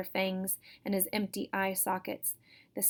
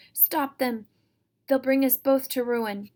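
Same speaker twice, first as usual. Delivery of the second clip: higher, louder frantic cry; 5 semitones higher, 7 dB louder than usual. Acted. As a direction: shout in panic to stop them. Auntie yells at the monster.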